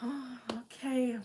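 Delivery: breathless whispered okay. Retold or imitated. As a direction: whisper it in shock. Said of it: "for me to be like".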